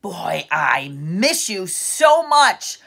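usual speaking voice

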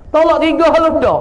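put-on a hot-headed young husband divorcing his wife in anger; angry husband. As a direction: hurl the divorce at her in a temper. Husband pronouncing triple divorce.

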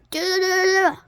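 trilled sound effect rolled out; mock trill. Character buzzing in disapproval.

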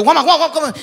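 llamado agudo y fuerte, riendo; llama al amigo con pánico; llamado desesperado. Retold or imitated. (llamando al amigo con desespero)

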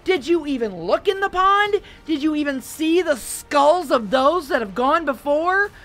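furious tirade. A narrator's reading. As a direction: build a terrifying, furious lecture. Markham rages at Melissa.

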